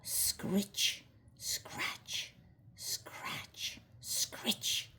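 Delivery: whispered scritch-scratch noises; breathy scraping sounds, very soft; hoe scraping sound. A narrator's reading. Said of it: "he heard the noise of a hoe".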